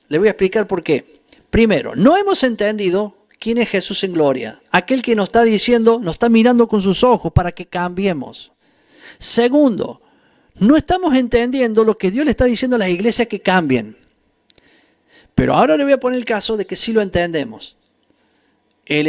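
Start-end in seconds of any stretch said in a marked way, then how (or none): none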